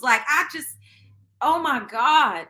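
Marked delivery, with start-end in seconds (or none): 1.4-2.5 pained oh my God. Real, not acted. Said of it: "This shit got me really in my feels".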